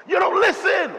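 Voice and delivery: shrieking, high and loud mock yell; mock yell at sister. Pastor imitating himself yelling at his sister, high shrill shout. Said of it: "my favorite line to my sister is".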